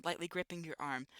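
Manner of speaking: ordinary delivery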